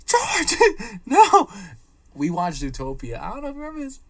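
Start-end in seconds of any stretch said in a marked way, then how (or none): 0.1-1.5 high, loud mock-horrified cry; hammed-up high exclamation of alarm; retold alarmed protest. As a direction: yell a pleading protest, half laughing. Acts own alarmed protest.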